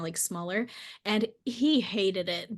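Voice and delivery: ordinary talk, nothing marked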